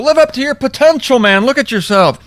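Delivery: high, preachy mock scolding; mock condescending churchgoer. Mocking churchgoers lecturing John the Baptist, preachy. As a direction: lecture him from above. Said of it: "These people would have looked down".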